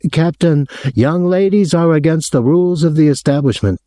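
high-pitched, aggrieved hotel official; aggrieved man. A narrator's reading. Hotel man phoning a guest about his visitor, aggrieved. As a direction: put on a high, officious voice of complaint.

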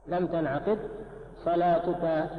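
ordinary talk, nothing marked

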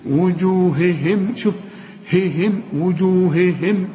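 وجوههم slow with both هـ breathed clearly; don't lose the weak haa in وجوههم; stressed haa. Shown as a demonstration. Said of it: "اوعى تضيع منك الهي".

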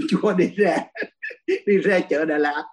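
words broken up by a laugh; amused laugh mid-story. Real, not acted. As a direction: chuckle through the phrase. Laughs while starting an anecdote.

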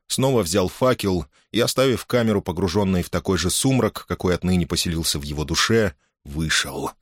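ordinary delivery

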